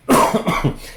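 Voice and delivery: dry cough from a cold; a short hacking cough. Real, not acted. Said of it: "I'm coughing a little bit".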